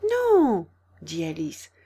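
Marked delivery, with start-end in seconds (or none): 0.1-0.6 high, loud no; emphatic denial. A narrator's reading. Alice correcting the rabbit, emphatic and high. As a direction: deny it emphatically.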